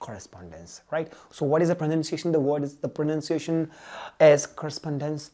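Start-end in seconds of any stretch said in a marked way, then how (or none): none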